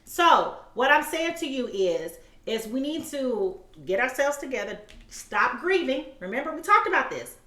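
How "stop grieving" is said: ordinary talk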